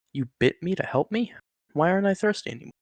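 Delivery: can't believe a snake is helping him; incredulous. Acted.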